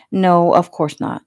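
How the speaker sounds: ordinary delivery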